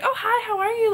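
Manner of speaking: very high, bright hello; imitated sweet greeting. Rebecca chatting with Josie Ann, imitated high sweet hello. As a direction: greet her like a delighted celebrity. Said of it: "she was like".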